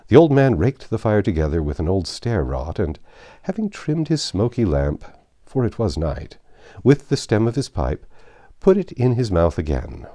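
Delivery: plain reading voice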